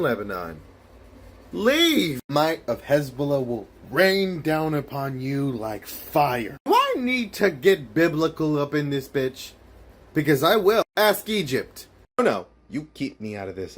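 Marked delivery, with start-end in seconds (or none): 1.5-2.2 loud. Acted. Threat yelled. 6.7-11.9 angry. Acted. Menacing shouted threat.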